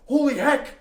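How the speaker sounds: loud, high exclamation; shocked shout. Retold or imitated. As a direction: yell it in disbelief. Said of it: "just was like".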